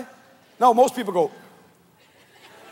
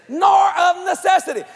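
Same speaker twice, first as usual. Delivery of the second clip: nor of necessity called out high and loud, punching nor; proclaimed loud; 8 semitones higher, 3 dB louder than usual. Shown as a demonstration. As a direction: ring out nor of necessity like a preacher hitting the verse. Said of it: "Listen to this".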